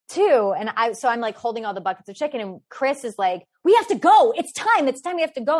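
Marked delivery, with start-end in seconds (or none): 3.6-5.6 high, loud and urgent; panicked hurry. Retold or imitated. Chris hurrying friends to the departing ferry, panicked and loud. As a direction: rush them, afraid to miss the ferry. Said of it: "he's, like, freaking out".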